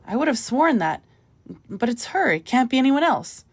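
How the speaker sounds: high, loud disbelief; can't believe her eyes; excited shock over the photo; astonished. A narrator's reading. Recognising an old friend's photo.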